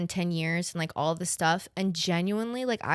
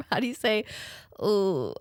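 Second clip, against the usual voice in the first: ordinary talk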